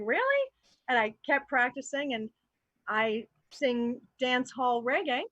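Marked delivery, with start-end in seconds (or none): 0.0-0.4 high, incredulous squeak; surprised really. Retold or imitated. Hearing she sounds Trinidadian, disbelieving. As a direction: act stunned at the news. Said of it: "So I was like".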